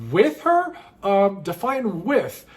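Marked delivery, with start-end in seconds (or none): 0.1-0.7 high rising disbelief; incredulous. Retold or imitated. Narrator dodging the bar guys, mock incredulous. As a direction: feign shock at the question. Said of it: "am I".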